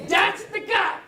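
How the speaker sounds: high, loud and overjoyed; excited recognition. Retold or imitated. Woman recognising the speaker, shouting with excitement. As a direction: cry out as you spot him. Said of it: "She said it like seven times".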